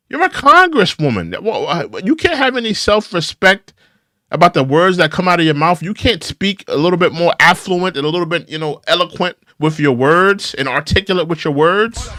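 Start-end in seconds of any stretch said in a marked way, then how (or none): none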